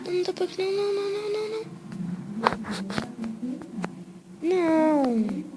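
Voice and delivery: rising high panicked nos; panicking gamer. Real, not acted. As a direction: panic as the enemy appears. Sees danger in game. (nãos em pânico, cada vez mais agudos)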